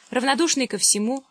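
ordinary delivery

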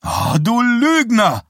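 high, loud, slow shriek. A narrator's reading. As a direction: shriek it, outraged yet giggly. Girl shrieks at teasing boyfriend.

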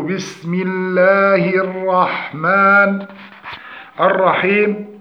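slow, measured Quran recitation with long vowels; correct recitation. Shown as a demonstration. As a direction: model each word slowly for students. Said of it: "فنقول". (تلاوة تعليمية متأنية)

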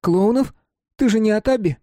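put-on high boyish voice; Mark's voice. A narrator's reading. Mark telling Simon it was fun, high boyish voice. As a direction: speak as the young friend Mark.

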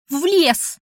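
high-pitched horrified lament; wailing creature. A narrator's reading. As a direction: cry it out high, aghast.

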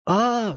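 sharp high cry of pain. Acted. Scott crying out as the man grips his leg, pained yelp. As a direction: yelp as the hand squeezes. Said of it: "скрикнул Скотт, морщась от боли".

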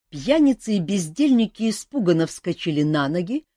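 plain reading voice

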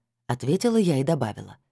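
plain reading voice

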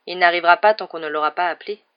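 ordinary delivery

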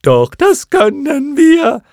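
very high, slow, loud squawk; clown moth calling down. A narrator's reading. First moth hops madly above Bastian, calling down. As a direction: call out defiantly, stretching each word. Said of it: "rief wieder zu Bastian hinunter".

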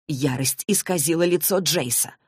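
ordinary delivery, nothing marked

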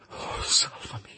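slow unvoiced begging; desperate whisper. Victor begging Clerval to save him, whispered. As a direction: plead in breathless terror.

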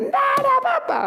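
high, sharp shout brushing it off; dismissive shout. Real, not acted. Preacher shouting down the congregation's complaints. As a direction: cut the whining short with a shout.

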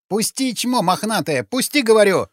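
high-pitched, frantic demand to be released; struggling yell. A narrator's reading. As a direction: cry out while kicking to get free. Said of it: "закричал сутенёр, брыкаясь".

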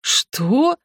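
высокий протяжный вопрос; воскликни, не веря услышанному; ошеломлённо. Acted. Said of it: "ошеломлённо воскликнули Старивел и отец". (ошеломлённый возглас)

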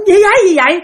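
high, loud alarmed cry; alarmed shout. Retold or imitated. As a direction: call out in alarm at the fire. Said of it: "bà la làng lên".

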